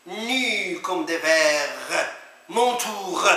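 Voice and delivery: plain reading voice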